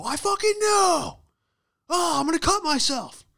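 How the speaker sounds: high, loud, over-the-top screaming; mock meltdown, screamed at full force; angry at yourself. Worker told he sucks demonstrates the trick, screaming self-blame. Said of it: "it's all you gotta do".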